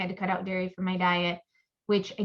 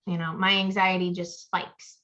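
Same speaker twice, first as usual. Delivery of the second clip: ordinary talk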